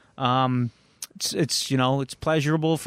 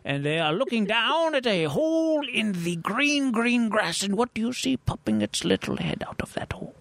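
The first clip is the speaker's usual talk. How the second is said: high, hushed storyteller whisper; storytelling whisper; 4 semitones higher than usual. Shown as a demonstration. Telling Baron's joke to the co-host, hushed and high. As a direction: draw listeners in with a secret hush. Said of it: "focus, if you will".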